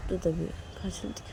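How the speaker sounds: plain reading voice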